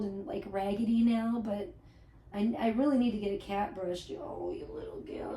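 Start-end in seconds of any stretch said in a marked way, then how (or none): 4.2-5.4 breathy whispered coo; hushed little whisper to the cat. Real, not acted. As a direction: barely whisper it to the pet. Murmuring to her cat.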